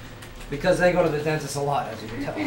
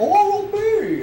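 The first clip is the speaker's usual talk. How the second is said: high goofy shout of the brand; Adam Sandler; 5 dB louder than usual. Acted. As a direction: yell Oral-B like a Sandler character. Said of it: "this is Adam Sandler".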